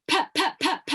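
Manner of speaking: short chopped P bursts on the lips. Shown as a demonstration. Coach leads, crisp chopped P bursts. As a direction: pop each P crisply. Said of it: "It goes like this".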